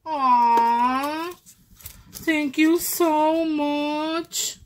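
high, loud, slow heartfelt thanks; moved, gushing thank you; touched thanks. Real, not acted. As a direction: pour out gratitude, stretching it. Touched by hidden gift.

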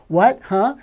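loud puzzled "What? Huh?"; mock hard of hearing. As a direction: bark it like a deaf old man. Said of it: "he played hard of hearing".

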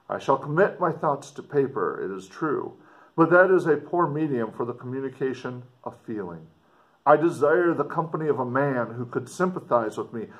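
ordinary delivery

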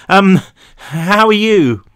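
loud, drawn-out unsure question; awkward. A narrator's reading. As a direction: drag out an awkward greeting. Stalling awkwardly on the phone.

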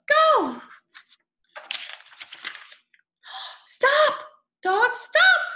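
excited calls of go and stop; calling out. Acted. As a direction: shout them on like a cheering kid. Calling out to the dogs.

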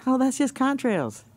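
a light sneering imitation of the doubters; mocking sceptic. Mocking viewers who dismiss the trails, in a high mock voice. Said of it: "For you out there who says".

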